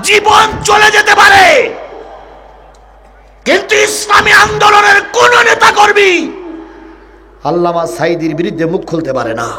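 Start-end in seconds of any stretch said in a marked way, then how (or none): 0.0-6.3 high, loud sustained shouted chant; full-force preaching. Acted. Preacher rousing the crowd about party loyalty, full-force shout. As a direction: thunder it out on one high note.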